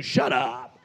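low-pitched, sassy dismissal; with attitude. Shown as a demonstration. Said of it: "just say it with an attitude".

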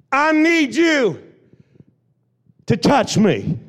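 high, loud desperate plea; crying out. Acted. Believer crying out to Jesus in worship, high loud plea. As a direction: shout for help, drawn out. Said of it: "passion that says".

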